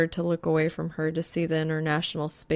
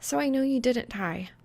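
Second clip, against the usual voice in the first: lightly voiced girl; 6 semitones higher than usual. A narrator's reading. Reaper continues, higher.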